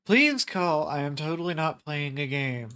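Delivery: hammed-up announcer read; infomercial voice. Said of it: "sounds like a fucking infomercial".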